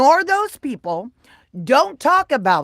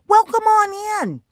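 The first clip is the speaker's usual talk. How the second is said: welcoming; 9 semitones higher, 3 dB louder than usual. Real, not acted. Welcoming a viewer.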